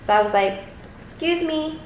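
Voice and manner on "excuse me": high, fairly soft "excuse me"; retold attention-getter. Woman to man in headphones, high tentative call. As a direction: politely get a stranger's attention, pitched up.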